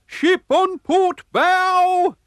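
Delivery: very high, loud, drawn-out lookout shout; lookout's cry. A narrator's reading. Tall lookout spots a ship, long high shout. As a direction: hail the ship from the crow's nest. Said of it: "Suddenly he cried".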